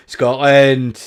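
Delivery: loud mock Scottish accent; bad Scottish accent. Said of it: "It's gonna be bad Scottish accent".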